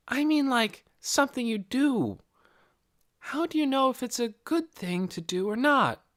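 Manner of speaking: put-on high, light girl's voice; a curious young girl asking; young girl Nikki. A narrator's reading. Nikki's lines in the story.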